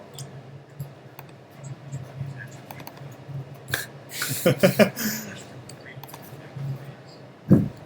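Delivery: breathy giggling fit; long laugh. Real, not acted. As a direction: laugh it out, high. Laughing at audio setup.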